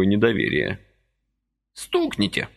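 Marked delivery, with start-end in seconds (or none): 1.7-2.5 put-on high sharp command from the old man; sudden order. A narrator's reading. Old man suddenly orders Vasily to strike, high command. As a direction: snap out an order. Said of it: "внезапно приказал он".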